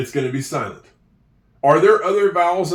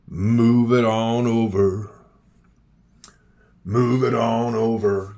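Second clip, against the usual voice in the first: slow sing-song; 5 semitones lower, slower than usual. Shown as a demonstration. Teacher slowly drawing out the phrase so pupils hear the V. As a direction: stretch each word slowly. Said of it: "Do you hear".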